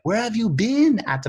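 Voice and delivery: exaggerated high French accent; a hammy French accent, loud and high; bad French accent. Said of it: "that's my bad French accent".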